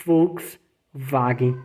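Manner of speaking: Volkswagen with German V sound, slow and careful. Shown as a demonstration. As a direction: say Volkswagen the German way, drawn out slowly. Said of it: "o melhor exemplo pra você treinar".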